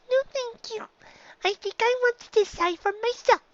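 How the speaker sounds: put-on high, small child's voice; little filly voice. Dicky declines teacher's help.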